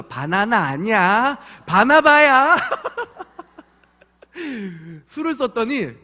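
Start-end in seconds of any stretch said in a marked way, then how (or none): 0.0-2.6 put-on loud, high child correction with 바나바 hit hard; correcting child. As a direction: insist 바나바야 against 바나나. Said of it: "술을 썼거든요". (바나나가 아니라고 힘주어 고치는 아이)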